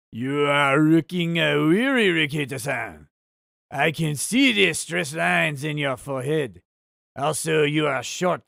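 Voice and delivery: hammed-up, badly faked Japanese accent. Streamer reading a viewer's message on request in a terrible Japanese accent. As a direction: attempt a Japanese accent that slips toward French. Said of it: "Read with Japanese accent".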